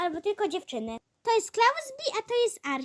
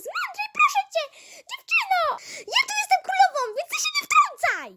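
high, loud, laughing royal scolding; bossy queen; 9 semitones higher, 3 dB louder, faster than usual. Acted. Sister acting as queen scolds the other, high and loud. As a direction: lord it over her sister as queen.

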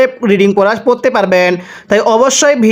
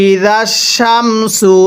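ই জাস Shamsu drawn out slowly, each syllable held; slow teaching demo of ই জাস Shamsu; much slower than usual. Shown as a demonstration. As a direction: spell it out slowly for students. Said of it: "মিলিয়ে পড়ব।".

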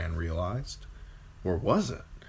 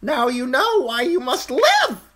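high, loud eerie crow voice; 8 dB louder than usual. A narrator's reading. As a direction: caw it out like an uncanny bird. Crow's voice.

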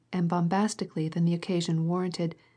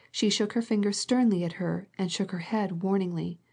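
plain reading voice

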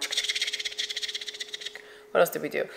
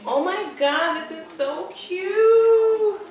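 high, slow, drawn-out "so cute"; gushing; 11 semitones higher, much slower than usual. Real, not acted. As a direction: coo over cute shoes. Gushing over shoes.

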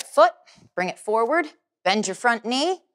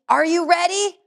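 excited; 9 semitones higher than usual. Real, not acted. Instructor hyping up the class.

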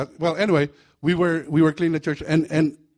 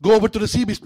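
demon sergeant; 6 semitones higher, 8 dB louder, faster than usual. Acted.

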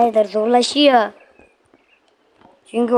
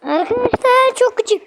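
high, loud complaint; exasperated whine; 13 semitones higher, 5 dB louder than usual. Real, not acted. Gamer complaining to viewers about an annoying level, exasperated.

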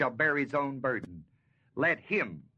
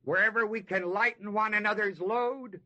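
building preaching; 5 semitones higher than usual. Acted. Preacher urges the church to share burdens, rising. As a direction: drive the appeal home.

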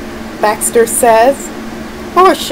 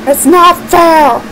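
angry villain rant; 7 semitones higher than usual. Acted. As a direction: rage about losing like a sore villain. Villain defeated, ranting.

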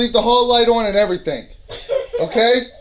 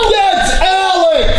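slow, high, insistent shout; alarmed shout; 5 semitones higher, slower than usual. Real, not acted. Insisting to the others it's Alex, high drawn-out shout. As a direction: shout it higher, dragging out each word. Startled, identifies who is making noises.

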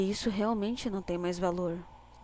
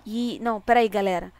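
ordinary delivery

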